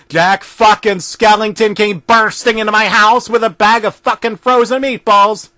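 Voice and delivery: plain reading voice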